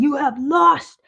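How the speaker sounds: high, loud, slow outraged cry; exasperated high yell. Real, not acted. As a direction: drag it out in disbelief. Outraged rant about hot dog.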